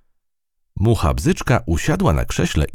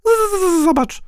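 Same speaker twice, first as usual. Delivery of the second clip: very high buzzing fly voice; amused fly; 3 dB louder than usual. A narrator's reading. Fly teases mouse about ungrateful ant, buzzing. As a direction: buzz on the z, high and teasing. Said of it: "roześmiała się mucha".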